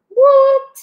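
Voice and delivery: high, loud whoop of joy; excited cheer. Acted. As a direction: whoop it out for the team. Team celebrates helpful math.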